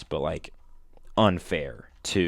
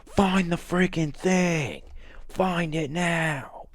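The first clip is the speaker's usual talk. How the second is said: higher, slow, forceful yelling; the jacked dude yelling at the girl, high and slow; mock yell; 10 semitones higher, slower than usual. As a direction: bark the order slowly, high and hard. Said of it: "he's yelling at you".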